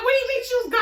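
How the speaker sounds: lightly voiced worried mother; mother demanding answers, alarmed; alarmed mom. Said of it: "She's like".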